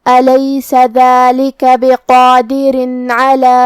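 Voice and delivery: slow chanted recitation with nasal ghunna; tajweed recitation. Shown as a demonstration. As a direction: stretch each vowel, chant it slowly. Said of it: "ہم یہ حکم کو غنہ کے ساتھ ادا کریں گے". (غنہ کے ساتھ آہستہ تلاوت)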